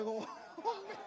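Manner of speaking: high, laughing embarrassment; cringing laugh; embarrassed laugh. Retold or imitated. Couple embarrassed.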